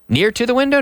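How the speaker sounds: "Near to the window?" asked with a high, doubtful rise; wrong version, questioned. Shown as a demonstration. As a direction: pose "Near to the window?" as the mistake to reject, rising high at the end.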